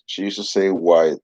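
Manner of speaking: usual speaking voice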